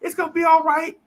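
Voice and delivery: high, soothing reassurance; comforting girl. Retold or imitated. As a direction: soothe him like a kind little girl. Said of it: "She hugging me".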